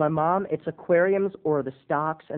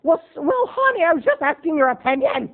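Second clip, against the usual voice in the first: high, louder defensive wife; mom; 10 semitones higher, 4 dB louder than usual. Retold or imitated. Wife defending herself to husband, high loud. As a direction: protest like Estelle Costanza. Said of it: "fighting like the Costanzas".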